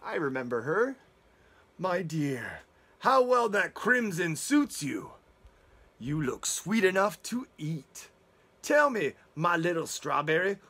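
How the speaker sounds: a smooth, slow-drawn big bad wolf flattering his prey; the wolf savouring every word to the girl; sly wolf. Wolf voice in story.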